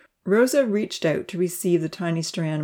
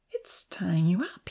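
hushed, slow amazement; marveling; 7 dB quieter, slower than usual. A narrator's reading. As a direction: marvel softly, drawing it out. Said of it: "he marveled".

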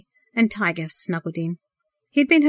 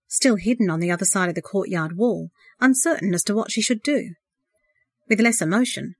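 usual speaking voice

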